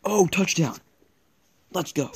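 high, loud touchdown shout; excited cheer. Real, not acted. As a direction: celebrate the score out loud. Celebrating a touchdown.

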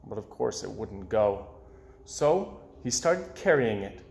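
usual speaking voice

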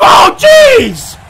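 high, loud burst of surprise; commentator's shocked exclamation; startled shout. Real, not acted. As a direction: cut yourself off with a shocked yell. Reacts to a move in the match.

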